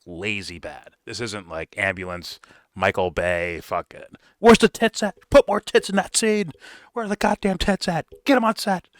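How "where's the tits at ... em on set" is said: high, loud mock producer yelling; hammed-up sleazy director barking orders; mock crude director. Imitating a sleazy director shouting for more tits.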